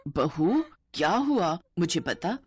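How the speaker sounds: ordinary delivery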